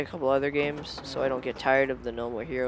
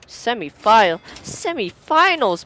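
surprised correction; 7 semitones higher, 10 dB louder than usual. Real, not acted. Correcting himself about the round.

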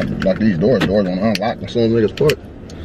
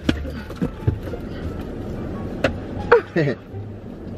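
1 real breathless laugh; 6 semitones higher, 8 dB quieter than usual. Real, not acted. Laughing at the prank he pulled.

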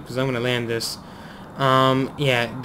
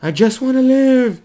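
high, loud cry for survival; playful, high-pitched wail; mock desperate plea; 10 semitones higher, 8 dB louder than usual. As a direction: plead loudly with the failing plane. Mock plea as plane fails.

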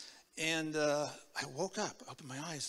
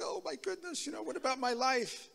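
put-on scared kid; 9 semitones higher than usual. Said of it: "I was like".

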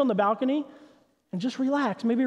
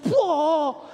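startled whoa; 6 semitones higher, 3 dB louder than usual. Retold or imitated. Toddler runs into glass door.

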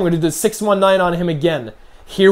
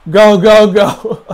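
urgent shout; 3 semitones higher, 9 dB louder, much slower than usual. Real, not acted. Urging in game.

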